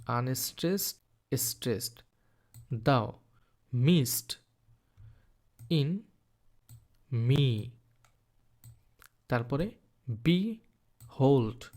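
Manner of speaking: each syllable named with a pause, then called stressed or unstressed; walk through the line, giving each syllable its stress; marking stress. Shown as a demonstration. Teacher to students, marking stress on each syllable one by one. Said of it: "এই হোল্ডটা হচ্ছে stressed".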